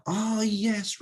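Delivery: put-on high Eastern European accent. A narrator's reading. Volunteer recognising the guest, high Eastern European accent. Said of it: "Eastern European accent".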